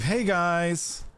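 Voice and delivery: put-on high, bright anime girl greeting; cute vtuber greeting. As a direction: chirp it like an idol. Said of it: "the classic Hololive".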